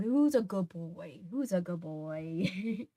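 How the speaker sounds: put-on slow, fading baby talk to a dog. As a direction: coo it to a good dog. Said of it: "my ooey gooey talking to dog voices".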